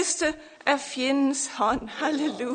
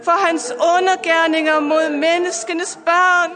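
building, louder sermon cadence over music; fervent preaching; 2 semitones higher, 4 dB louder than usual. Acted. Pastor preaching to listeners online, fervent and rising.

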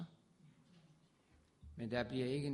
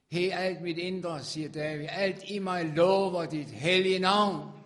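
exultant praise; 5 semitones higher, 12 dB louder than usual. Acted. Preacher voicing David praising God, loud and exultant. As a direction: lift it up with everything inside you.

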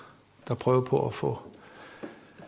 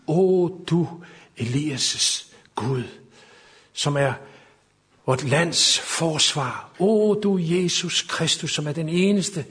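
fervent prayer; 6 semitones higher, 6 dB louder than usual. Acted. Preacher praying aloud for the nation, pleading and rising. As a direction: cry out to God for strength and protection.

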